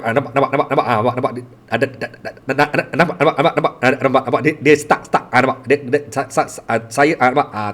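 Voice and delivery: "nampak" and "dia" repeated in stuck, rapid fragments; real blocked, repeating stammer; severe stammer. Shown as a demonstration. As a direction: stutter hard on "da" and "sa", unable to get past them. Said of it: "Itu gagap".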